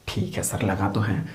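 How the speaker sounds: muttered under the breath as a sulky student; grudging student, barely audible; a student conceding without conviction; mock student mumble.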